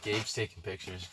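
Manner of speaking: ordinary talk, nothing marked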